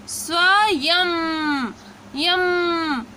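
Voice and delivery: स्वयं stretched very slowly, then यम isolated; drawn-out pronunciation demo. Shown as a demonstration. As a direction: pull स्वयं apart to show the यम ending. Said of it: "pronunciation आपका बदल रहा है". (स्वयं को बहुत धीरे खींचकर, फिर यम अलग से)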